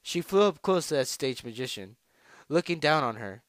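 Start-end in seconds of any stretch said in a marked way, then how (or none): none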